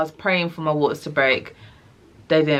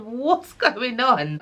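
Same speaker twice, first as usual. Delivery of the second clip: higher, slow, drawn-out confusion; mock bewildered; 8 semitones higher, much slower than usual. On the ball, she asks the midwife, slow and bewildered. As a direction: stretch it out, baffled.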